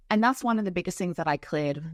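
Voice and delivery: usual speaking voice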